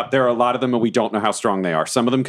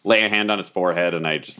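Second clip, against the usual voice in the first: ordinary delivery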